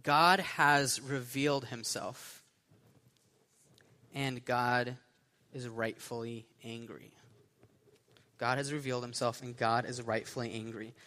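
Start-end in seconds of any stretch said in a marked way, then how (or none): 0.0-7.1 slow, spaced-out announcement with long pauses; slow sermon point. Real, not acted. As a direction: give it out for note-takers, phrase by phrase. Announces point for note-takers.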